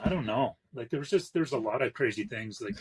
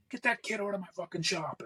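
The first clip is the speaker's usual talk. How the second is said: retold rage; 8 semitones higher than usual. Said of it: "he lost his shit".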